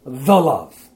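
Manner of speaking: weight "The" as its own deliberate word before "love"; stress on THE. Shown as a demonstration. Teacher repeating the reading with the article, stress on THE. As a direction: emphasise "The" in "The love". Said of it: "so it'd read this".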